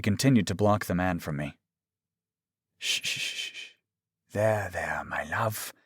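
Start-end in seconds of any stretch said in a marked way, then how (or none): 2.8-5.7 whispery shushing and tender murmur; soft soothing hush. A narrator's reading. Doctor comforting his upset lover, soothing.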